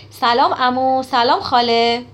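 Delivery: loud, drawn-out hello to relatives; effusive greeting. A narrator's reading. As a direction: call the greetings out, stretching the last word. Girl greets uncle and aunt.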